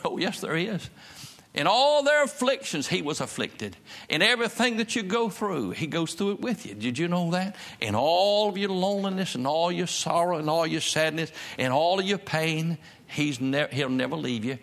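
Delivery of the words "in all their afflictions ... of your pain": high, swelling preacher's delivery; impassioned preaching. Real, not acted. Preacher assuring the congregation God shares their afflictions, impassioned preaching. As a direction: lean hard and long on each "all". Preaches the verse with rising force.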